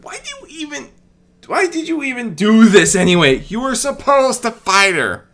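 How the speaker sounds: loud, high exasperated yell; angry shouting. Acted. Nymeria shouting at Medea for meddling, loud and exasperated. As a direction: demand an answer at full volume.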